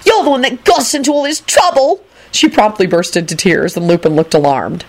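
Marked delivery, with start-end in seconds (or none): 0.0-2.0 high, accusing wail; plaintive girlish whine. A narrator's reading. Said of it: "Rosie wailed".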